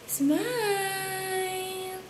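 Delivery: high soft coaxing. Real, not acted. Parent coaxing the baby to smile, high soft singsong.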